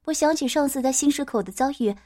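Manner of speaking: ordinary delivery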